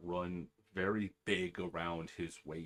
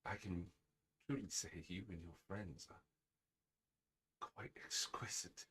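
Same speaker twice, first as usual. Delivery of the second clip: hushed, halting delivery; soft-spoken gentleman character; 3 semitones lower, 12 dB quieter than usual. Acted. Game master voicing a courteous stranger, soft-spoken.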